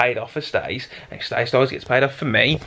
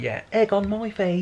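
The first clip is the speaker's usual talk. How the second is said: sheepish admission; 6 semitones higher, slower than usual. Retold or imitated. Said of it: "and I was like".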